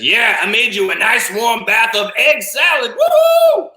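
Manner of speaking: high excited shout, rising to a whoop; gleeful octopus. Acted. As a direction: whoop it up like a wild party host. Octopus gleefully reveals egg-salad bath.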